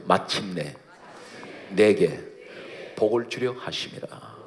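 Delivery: slow, drawn-out verse ending; measured, stately recitation; solemn scripture quotation. Acted. Scripture quoted, then explained.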